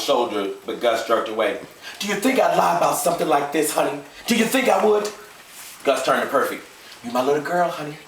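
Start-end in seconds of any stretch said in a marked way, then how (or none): 2.0-8.0 higher-pitched, insistent father figure; lightly voiced daddy reassuring his child. Father to his little girl, earnestly reassuring.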